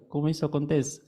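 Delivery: normal talking voice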